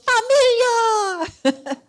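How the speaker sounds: high, loud, very slow "Familia!"; a long, loud, high greeting called to the couple; drawn-out call. Retold or imitated. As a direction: stretch the call across the street. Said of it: "he goes".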